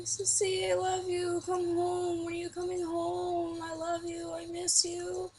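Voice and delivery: high, loud mock-crying child voice; drawn-out, high-pitched imitation of a weepy boy; imitated crying little brother. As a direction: whine it out like a tearful kid. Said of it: "I hear him crying".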